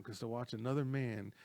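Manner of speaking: normal talking voice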